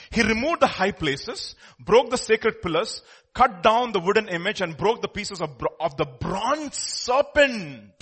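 loud, slow, emphatic Bible reading; forceful scripture proclamation; preaching scripture. Real, not acted. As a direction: declaim it with drawn-out key words.

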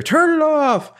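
high, loud heroic yell; hammed-up space hero shout; Han Solo shout. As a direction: bark the order like Han Solo. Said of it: "[name] does her best Han Solo here".

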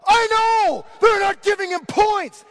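high, loud outraged shout; furious fan at the game; screaming fan. Retold or imitated. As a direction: yell back with no shame. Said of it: "that's the tone of voice".

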